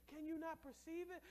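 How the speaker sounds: normal talking voice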